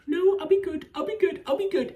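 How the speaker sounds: very high, loud hammed-up begging; mock frantic plea. Reviewer acting out her begging him not to call a doctor, mock frantic plea. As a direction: imitate her pleading in a squealed rush of promises.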